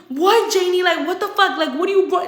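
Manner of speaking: loud, high imitation of his outburst; mock exasperation. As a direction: throw it back at her, dramatically fed up. Acting out his exasperated retort.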